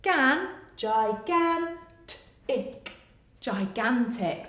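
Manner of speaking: "gigantic" blended slowly; sounding out. Shown as a demonstration. Phonics teacher blending a long word for children, slowly. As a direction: build "gan" into "gigantic" slowly. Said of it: "A, N".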